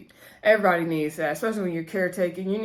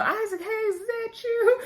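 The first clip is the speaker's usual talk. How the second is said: put-on much higher, crackly old lady calling out; old grandmother voice. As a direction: squeak it like a frail grandmother asking who's there. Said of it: "she was like".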